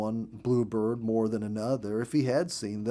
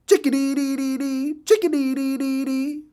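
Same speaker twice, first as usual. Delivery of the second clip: very high, loud, sing-song bird call; saucy little bird chirping its name; saucy chickadee call; 7 dB louder than usual. A narrator's reading. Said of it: "he repeated in his sauciest air".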